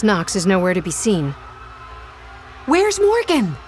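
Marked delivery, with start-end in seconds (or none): 2.6-3.6 shouting. Acted. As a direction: yell it across the fight. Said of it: "he shouts at Abe".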